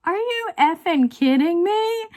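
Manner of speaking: high, loud mock outrage in a friend's voice; Stitcherista outburst. Imagining her friend's reaction to the dog, high loud disbelief. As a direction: belt it out like the storyteller she imitates. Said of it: "I could hear Stitcherista going".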